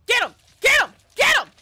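very loud, high cheering yell; screaming for the teammate to attack; frantic shout. Real, not acted. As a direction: scream it at the screen.

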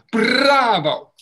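exaggerated loud bravo; big mouthed bravo. Shown as a demonstration. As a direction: shape every sound big. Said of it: "I want to see your mouths move".

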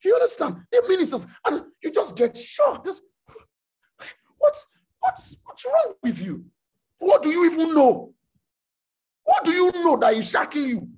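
high, loud incredulous rebuke; shocked outburst. Acted. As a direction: shout at them in disbelief.

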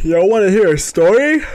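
high, loud showy invite; playful announcing. Real, not acted. Streamer offering viewers a story, playful and loud. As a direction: hype up the chat.

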